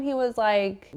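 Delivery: normal talking voice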